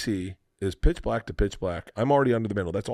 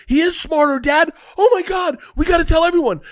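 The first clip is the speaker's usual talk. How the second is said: put-on high, loud, thrilled child yelling; hammed-up kid shout, much higher and louder; excited shouting kids; 8 dB louder than usual. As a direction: yell like kids who just caught Santa.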